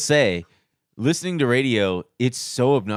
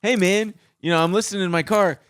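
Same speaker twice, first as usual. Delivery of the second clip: higher, friendly caller voice; retelling his own call to the DJ; polite caller; 6 semitones higher than usual. Retold or imitated. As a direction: politely ask him to stop. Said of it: "I just was like".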